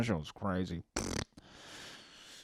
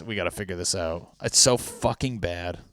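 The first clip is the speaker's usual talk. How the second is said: ordinary talk, nothing marked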